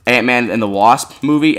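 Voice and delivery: ordinary talk, nothing marked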